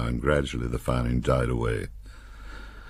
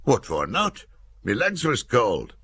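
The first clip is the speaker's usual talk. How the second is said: higher, slow northern shrug; Nick, offhand; 9 semitones higher, slower than usual. A narrator's reading.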